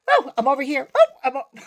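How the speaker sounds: high, loud puppet yelps; mock puppet voice. Puppeteer mocking a badly walked puppet, exaggerated yelps. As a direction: pop out each "Oh" like a silly puppet.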